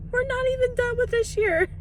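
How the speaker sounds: high, stunned excitement; can't believe how well it went; giddy amazement; amazed disbelief. Real, not acted. Excited over doubled sales.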